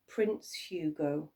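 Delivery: ordinary delivery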